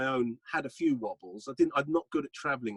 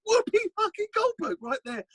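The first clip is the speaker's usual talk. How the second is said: much higher, thrilled disbelief; starstruck. Real, not acted. Telling the host about meeting stars, thrilled and high. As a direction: gush like a starstruck fan.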